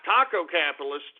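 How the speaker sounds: usual speaking voice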